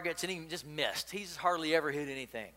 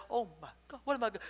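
a boy's hushed freak-out, acted; 6 semitones higher, much faster than usual. A narrator's reading. Boy has just killed the duck, mildly panicked. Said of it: "He freaks out".

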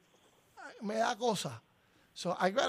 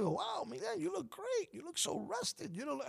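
high, soft and amazed, quoting people; impressed friends; 10 semitones higher, 8 dB quieter than usual. Retold or imitated. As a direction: gush like a friend who is impressed. Retold compliments from everybody.

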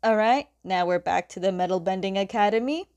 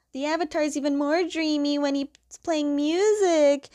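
higher dreamy fangirl voice; higher, swooning fangirl gush; 8 semitones higher than usual. Retold or imitated. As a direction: gush like a starstruck fan.

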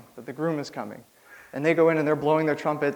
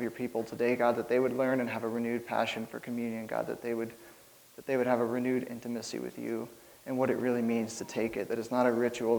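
soft, earnest prayer voice fading quieter; 4 dB quieter than usual. Acted. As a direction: pray humbly over the congregation. Leads an opening prayer.

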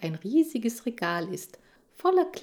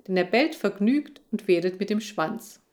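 ordinary delivery, nothing marked

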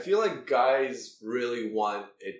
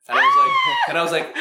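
excited; 13 dB louder, much faster than usual. Real, not acted. Exploding at coworker's claim.

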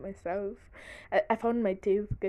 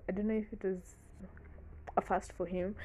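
normal talking voice

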